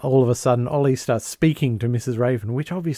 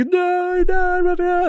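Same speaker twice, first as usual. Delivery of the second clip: high, wailing mock grief; squealed fake anguish, pitched way up; hammed-up upset; slower than usual. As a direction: badly overact being distraught. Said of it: "he has to do this acting job like he's all upset".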